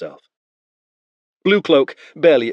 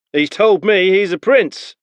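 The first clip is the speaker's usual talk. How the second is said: higher doubtful soldier; suspicious spearman; 10 semitones higher, 6 dB louder than usual. Acted. Spearman tells Beren the boy's claim, suspicious. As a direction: keep the doubt in it.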